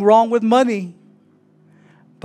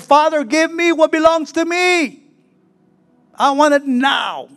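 demanding shout; 7 semitones higher than usual. Acted. Prodigal son demands his share.

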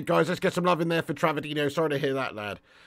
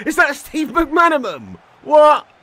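slow, high and louder; shocked disbelief; 8 semitones higher, 6 dB louder, slower than usual. Real, not acted. Streamer asking viewers in shock about a player, high and incredulous. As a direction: stretch the name out in shock.

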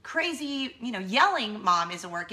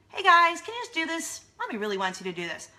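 high, sweet opening easing down to usual pitch; nice mom; 7 semitones higher, 6 dB louder than usual. Retold or imitated. Mom asking her kids to cooperate, nice and coaxing. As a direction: coax the kids extra nicely. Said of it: "like, nice".

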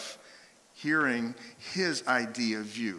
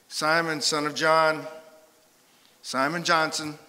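louder, firm parental summons; stern full-name call; 2 semitones higher, 4 dB louder than usual. Acted. Jesus to Peter by full name, stern summons. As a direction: call out each name like a scolding mom. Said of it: "your mom would call you by your full name".

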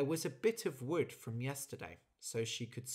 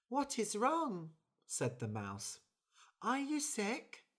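concerned mouse; 9 semitones higher than usual. Acted. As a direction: ask gently, pitched up like a tiny mouse. Mouse voice, high and slow.

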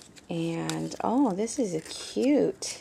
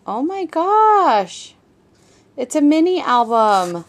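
amazed gasp; 6 semitones higher, 7 dB louder than usual. Real, not acted. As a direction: let real amazement stretch the words. Opening a surprise birthday gift.

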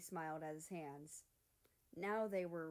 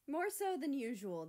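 higher, louder teasing jab; sly; 7 semitones higher, 6 dB louder than usual. Acted. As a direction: say it with a sly grin. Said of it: "piped up with a sly grin".

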